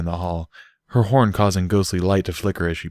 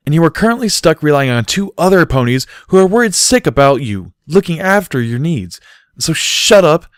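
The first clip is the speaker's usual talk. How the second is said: higher, louder scolding tirade; exasperated telling-off; scolding rant; 7 semitones higher, 6 dB louder than usual. A narrator's reading. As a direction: tear into him to shake him up. Barry scolding Bucky.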